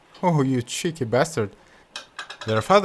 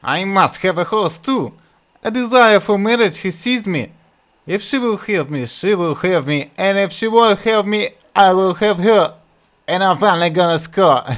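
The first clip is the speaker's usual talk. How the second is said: high, loud foolish whine; whiny Butthead; 7 semitones higher, 6 dB louder than usual. Acted. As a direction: plead like a silly boy. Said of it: "whined Butthead".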